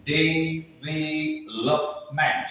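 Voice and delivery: Development drawn out very slowly, each syllable apart. Shown as a demonstration. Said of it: "जब आप development को पूरा बोलेंगे".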